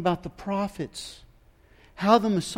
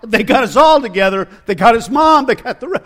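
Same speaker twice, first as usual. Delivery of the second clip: loud, higher frantic warning that they're coming; panicked alarm; 4 semitones higher, 11 dB louder than usual. Retold or imitated. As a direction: cry out that the end has come, much louder. Terrified disciples expecting arrest.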